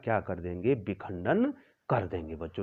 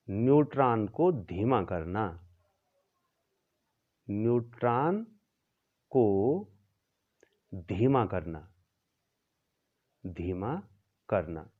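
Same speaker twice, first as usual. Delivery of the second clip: slow dictation; 2 semitones lower than usual. Shown as a demonstration. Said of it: "teacher dictating slowly".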